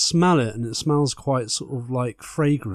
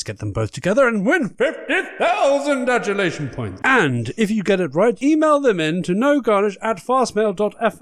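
campy drag queen; 6 semitones higher than usual. Acted. Host explains rules.

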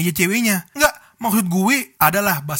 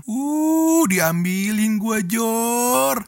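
gloating; 4 semitones higher, much slower than usual. Retold or imitated. Friend gloating, voiced in story.

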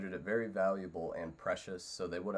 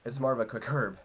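quick herb voice; 3 semitones higher, 10 dB louder, faster than usual. Acted. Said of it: "What was that?".

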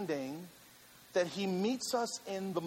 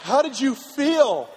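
loud, high, stretched-out question; retold eager question; 5 semitones higher, 9 dB louder, slower than usual. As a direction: ask it big and slow, falling at the end. Retold eager question to rescued wife.

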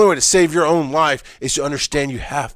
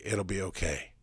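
soft, low reassurance; hushed, lower, consoling; gentle reassurance; 5 semitones lower, 13 dB quieter than usual. Retold or imitated. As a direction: say it very softly, as if comforting someone. Imagined reassurance from one person.

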